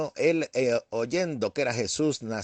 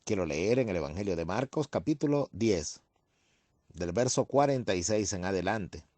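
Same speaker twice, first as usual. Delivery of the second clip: scripture reading; 4 semitones lower than usual. Acted. Reads scripture passage aloud. (lectura bíblica solemne, más grave y suave)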